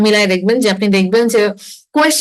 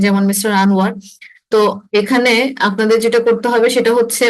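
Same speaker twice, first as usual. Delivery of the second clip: ordinary talk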